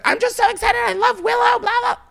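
squealed high and breathless with excitement; imitating her own starstruck squeal; gushing fangirl. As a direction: gush like an overexcited fan. Gushing fan quote to Adore.

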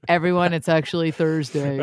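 lightly voiced male impression; a hint of a man's voice. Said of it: "good impression".

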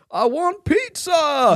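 put-on high, slow little-kid whine; high-pitched child voice, drawn out. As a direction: mimic a small child asking. Quoting the twins' video line.

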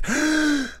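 sharp high gasp for air; winded gasp. Real, not acted. Comedian bent over a counter, gasping for breath. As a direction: suck in a breath like your lungs are squashed. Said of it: "I'm bent over right now, leaning on a counter".

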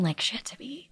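hushed, voiceless aside; whisper. Real, not acted. Mom asks the hosts if she can swear, whispering. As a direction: whisper it like a naughty word. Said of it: "can I cuss on this".

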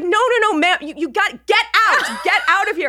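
high, stammering alarm, rising in urgency; frantic nurse. Retold or imitated. Nurse ordering patient out of radiation room, frantic.

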